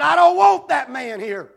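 loud, slow, firm refusal; angry objection. Retold or imitated. Board member refusing the returning man, loud and slow. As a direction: plant your feet and refuse him loudly.

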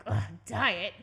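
loud, disgusted groan at the word; mock disgust. Handed a Diet Coke, mock disgust. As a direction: play up the letdown in mock dismay. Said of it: "I didn't wanna be like".

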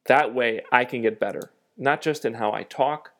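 usual speaking voice

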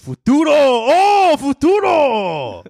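put-on very high, loud, drawn-out Japanese-accented cry; mock angry Japanese accent. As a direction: shout it like an over-the-top Japanese DJ. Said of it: "like an angry Japanese guy".

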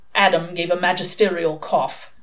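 ordinary delivery, nothing marked

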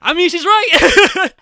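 loud, high, drawn-out exclamation; exclaimed agreement. Retold or imitated. Co-host siding with the angry character, loud exclaimed agreement. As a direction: burst out in emphatic agreement, stretched out. Acts out own amused reaction.

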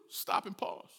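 ordinary talk

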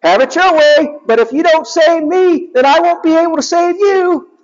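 high smug hedgehog hero; Sonic's voice. Retold or imitated. Sonic answers the robots.

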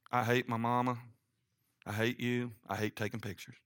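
low, sullen mock grumble. One unsmiling person in the Christmas card, sullen grumble. Voicing the unsmiling family member.

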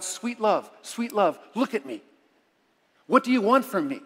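fervent poem recitation; impassioned plea. Acted. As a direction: call out to God with urgency. Repeats the poem's plea to God.

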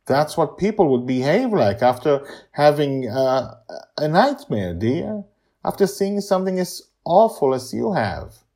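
high, loud soothing grandma; kind grandma voice. Acted. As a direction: comfort her like a granny. Susie comforts Joy.

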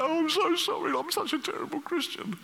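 high-pitched, hammed-up wail of guilt; mock self-abasement. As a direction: grovel in exaggerated remorse. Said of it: "dig a big hole and jump into it".

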